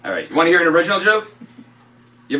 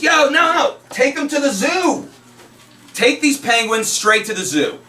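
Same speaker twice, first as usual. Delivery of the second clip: higher, slower cop setting the driver straight; a lightly voiced cop giving orders; a hint of a bossy cop, higher and slow; joke cop voice; 8 semitones higher, slower than usual.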